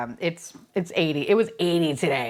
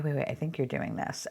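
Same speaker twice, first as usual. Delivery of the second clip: normal talking voice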